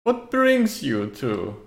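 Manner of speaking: put-on slow, swooping, theatrical old host; old necromancer. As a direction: play a fussy old necromancer greeting visitors. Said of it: "every time you talk as this necromancer".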